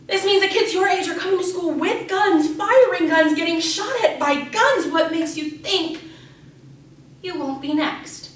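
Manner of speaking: high, mounting alarm; alarmed warning. Acted. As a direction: scare the kids with rising urgency. Alarming the children about guns.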